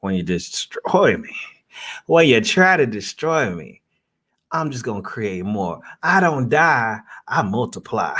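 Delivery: mock sermon, building with fervour; preacher. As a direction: preach it from a pulpit. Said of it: "there's a sermon in there somewhere".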